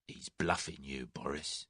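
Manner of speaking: a quiet aside to Boris; quietly. A narrator's reading. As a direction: warn him quietly, almost under the breath. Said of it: "he said quietly".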